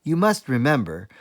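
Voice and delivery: ordinary delivery, nothing marked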